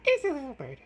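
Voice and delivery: very high squeaky coo. Real, not acted. Owner squeaking at her little bird, very high. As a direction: push it way up into a tiny squeak. Repeat even higher.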